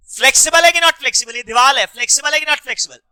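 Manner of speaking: loud, high, rapid-fire question; insistent loud quizzing. Real, not acted. Teacher demands an answer about the wall, loud and fast.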